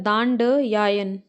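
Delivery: दांडयायन said slowly, syllable by syllable; slow spelled-out word. Shown as a demonstration. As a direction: stretch दांडयायन out for the class. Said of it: "क्या होता है?". (धीरे धीरे दांडयायन)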